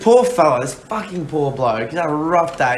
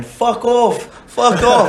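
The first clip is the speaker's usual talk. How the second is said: high, louder yelled fuck off; mock angry shout; 9 semitones higher, 5 dB louder, much slower than usual. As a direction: shout him down in a mock rage. Quoting a hostile front rower.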